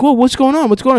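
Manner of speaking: put-on high-pitched alarmed kid; mock startled. Kid walking in on teammates, startled. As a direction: imitate a bewildered kid walking in.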